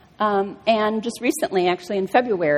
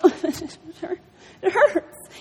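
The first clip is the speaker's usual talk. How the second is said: laughing whimper; 9 semitones higher than usual. Retold or imitated. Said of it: "She's like".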